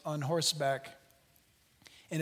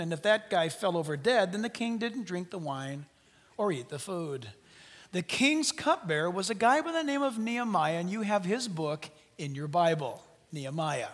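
normal talking voice

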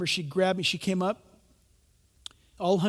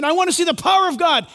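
mock demand; 8 semitones higher, 8 dB louder, faster than usual. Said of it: "Some of you might be saying".

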